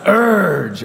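high, drawn-out pirate URGE; pirate emphasis. Shown as a demonstration. Preacher rereading the verse to the congregation, pirate-style emphasis. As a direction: lean hard on URGE like a pirate. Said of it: "Okay, emphasis. Emphasis".

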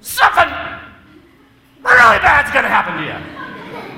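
high, loud, slow exasperated yell; idle threat. Retold or imitated. Parent in the car yelling a threat at his son, loud and slow. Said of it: "you finally say to him".

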